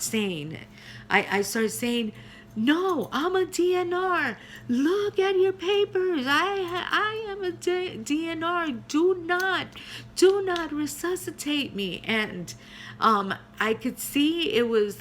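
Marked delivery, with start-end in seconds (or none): 2.6-12.0 high, urgent pleading; high-pitched frantic plea, retold; desperate plea. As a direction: beg them to stop, desperately. Patient begs not to be resuscitated.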